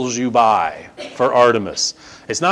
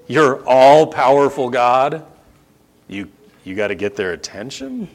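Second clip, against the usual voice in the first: slow incredulous repeat. Acted. Preacher questioning the all-powerful God excuse, slow and incredulous. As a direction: repeat it back haltingly, in disbelief. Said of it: "say that again slowly".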